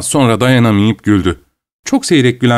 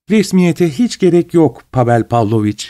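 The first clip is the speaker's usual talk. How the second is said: sing-song voice; 5 semitones higher than usual. A narrator's reading. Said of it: "şarkı söyler gibi bir sesle".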